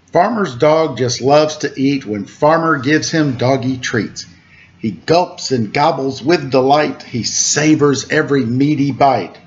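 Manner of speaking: sing-song rhyming picture-book read; reading to children. A narrator's reading. Host reads a farm picture book to kids, lilting. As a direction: lilt the rhymes for little listeners.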